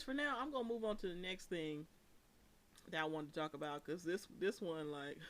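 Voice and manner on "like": usual speaking voice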